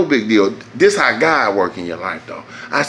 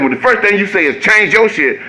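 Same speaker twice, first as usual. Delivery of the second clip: ordinary talk, nothing marked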